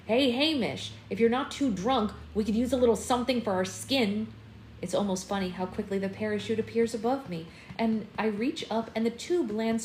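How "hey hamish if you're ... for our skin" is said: sharp irritated call to Hamish; snapping. Acted. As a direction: snap it out, fed up. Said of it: "I snap".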